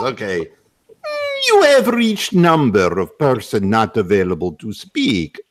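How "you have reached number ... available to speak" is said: put-on heavy Russian accent on a phone message; Russian answering machine voice; thick Russian accent. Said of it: "all I do is the Russian voice".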